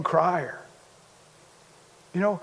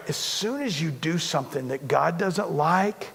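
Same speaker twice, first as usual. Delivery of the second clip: ordinary talk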